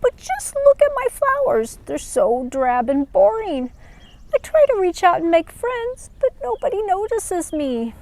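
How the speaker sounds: high, small voice of a drab little flower; plaintive little flower; little flower, sad. Acted. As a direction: complain she is never noticed. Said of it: "insisted the little flower".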